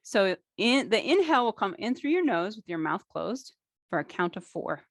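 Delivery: ordinary talk, nothing marked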